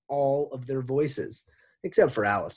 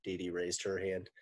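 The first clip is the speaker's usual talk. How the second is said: plain reading voice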